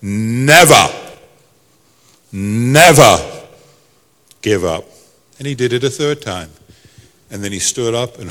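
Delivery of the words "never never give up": put-on Churchill-style English accent, louder on each "never". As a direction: repeat it as a weighty English statesman. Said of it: "And he repeated it again".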